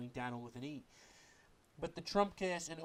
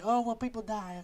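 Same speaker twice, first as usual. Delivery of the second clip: mocking; 9 semitones higher, 10 dB louder than usual. Mocking what critics say.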